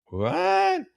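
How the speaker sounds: high-pitched, stunned "what?"; incredulous. Real, not acted. Host reacting to a listener's letter, incredulous. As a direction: squawk it in sheer disbelief.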